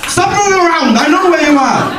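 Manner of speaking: high, hammed-up teacher giving detention; mock angry teacher. Teacher keeping the class clown behind, mock stern. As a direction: bark it like a fussy teacher.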